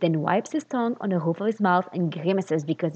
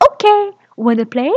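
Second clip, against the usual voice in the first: bright, louder invitation; beaming; 3 semitones higher, 6 dB louder than usual. Acted. Beaming boy invites Otabek to play, bright and loud. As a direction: invite him to play, delighted.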